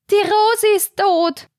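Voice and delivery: put-on high piercing old woman's cry; shrill voice. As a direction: screech the news across the room. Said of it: "die schrille Stimme der mageren Martha". (schrille, hohe Stimme einer alten Frau)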